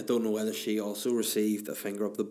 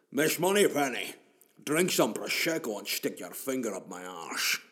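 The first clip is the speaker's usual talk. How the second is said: Sean Connery impression. Bond talks to Miss Moneypenny in a Scottish accent. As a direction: ham up a Scottish Bond with every s as sh. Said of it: "Sean Connery, anything with an S".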